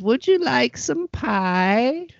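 high, slow granny offering pie; sweet old granny, slowly; grandma voice. Acted. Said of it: "Yes, Grandma".

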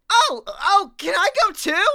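very high, loud, eager cartoon creature; Figment. Little creature begging to join the tour, high eager squeal. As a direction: squeak it out in an excitable little character voice. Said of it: "I've gotta be Figment".

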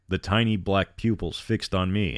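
ordinary delivery, nothing marked

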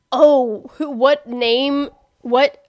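searching aloud, then loud on the name; mock scheming. Imitating someone chasing attention, mock eureka. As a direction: act out hunting for a name, then announce it. Acts imagined clout-chaser.

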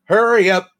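loud drill sergeant bark; barked order. Retold or imitated. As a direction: bark it up the ladder. Drill sergeant yelling up ladder.

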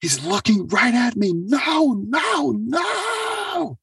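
very high, shouted no; terrified scream. Retold or imitated. Dying patient seeing the entity, very high screaming. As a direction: scream it out in terror. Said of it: "And when she said".

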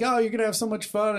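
high, fast gushing filly; gushing girly voice. Acted. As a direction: gush over the new girl.

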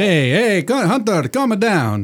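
put-on thick comic Italian accent; hammed-up Italian genie. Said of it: "poor attempt at an Italian accent".

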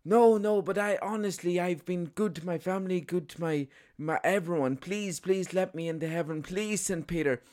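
put-on high, pleading French accent; botched French accent. Footballer begs to get into heaven, high mock-French pleading. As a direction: beg desperately in a hammed-up French accent. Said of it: "German accent there".

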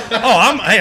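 high, loud laughing words. Real, not acted. Laughs off the crying question.

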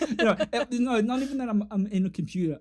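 high, annoying little virus voice; cute germ voice. Pretend. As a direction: play a cheery pest of a virus.

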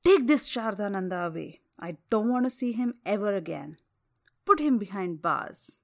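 loud furious order to guards; angry command. Acted. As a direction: bark the order. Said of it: "called upon his soldiers and said".

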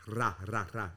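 "ra" with a trilled, rolled R. Shown as a demonstration. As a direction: roll the R hard on "ra". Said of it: "You roll the R".

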